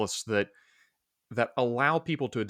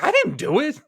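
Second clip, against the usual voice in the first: squeaky, much higher and louder denial; mock innocent protest; 9 dB louder than usual. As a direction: play the falsely accused, squealing. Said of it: "It's like".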